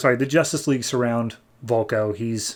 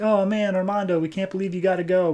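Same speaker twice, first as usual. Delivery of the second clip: higher, quick, imitated group lament; mock disappointed brothers; 7 semitones higher than usual. Brothers see Armando off to college, mock disappointment. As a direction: lightly whine it as the brothers.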